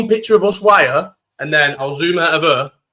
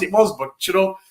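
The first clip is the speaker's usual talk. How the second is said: ordinary delivery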